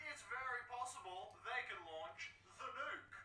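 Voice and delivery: high, pleading captive scientist voice. Acted. Captive scientist to Snake, high and pleading. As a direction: beg the rescuer to get you out. Donald voice.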